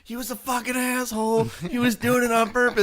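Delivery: high, loud mock rant; imitated road rage. Imagined uncle fuming about his parking spot, loud and high. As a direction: rant like a man pounding the steering wheel. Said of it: "pounding his steering wheel going".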